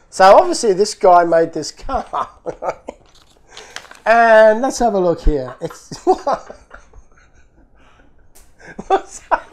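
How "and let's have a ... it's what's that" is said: ordinary talk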